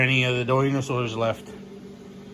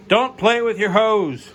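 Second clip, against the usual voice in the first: mock scolding; 6 semitones higher, 5 dB louder than usual. Scolding his dog.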